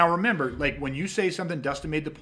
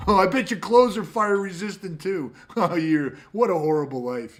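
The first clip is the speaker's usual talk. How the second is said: mock sneer; 3 semitones higher, 5 dB louder than usual. Mocking critic's sneer.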